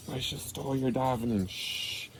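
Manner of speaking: very soft, conspiratorial murmur; hushed telling, trailing into a shush; hushed secret. Pretend. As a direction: let slip a secret under your breath. Whispered secret kept from the angels.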